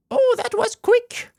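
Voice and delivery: very high, slow squeaky voice; Paimon voice. Acted. Streamer reading Paimon lines in a very high voice. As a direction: pitch it up like a chirpy mascot. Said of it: "that shitty-ass voice for Paimon".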